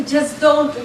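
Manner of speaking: usual speaking voice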